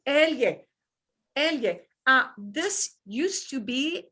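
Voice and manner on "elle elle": elle said as "el-ye", with a Y glide; Spanish elle. Shown as a demonstration. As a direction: say elle as "EL-yeh". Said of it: "I'm gonna preview this now".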